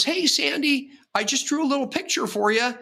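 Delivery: cheerful patient. Retold or imitated. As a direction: sound sunny and upbeat, like nothing's wrong. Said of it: "he's got this happy attitude".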